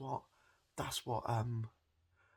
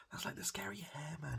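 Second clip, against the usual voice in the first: whisper; 9 semitones higher, 3 dB quieter than usual. Real, not acted. Whispered aside about hair man.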